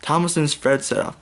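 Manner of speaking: plain reading voice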